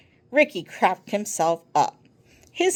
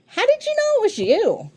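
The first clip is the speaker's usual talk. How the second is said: puzzled question; 13 semitones higher than usual. Acted. Abby's probing question.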